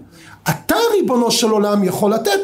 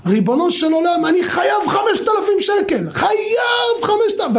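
retold scream; 9 semitones higher than usual. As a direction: cry out to heaven like the man who begs. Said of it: "אני צורח".

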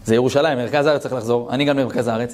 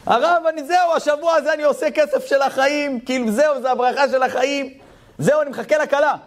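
elated high voice; 12 semitones higher than usual. Retold or imitated. Elated man on phone.

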